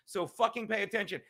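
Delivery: ordinary talk, nothing marked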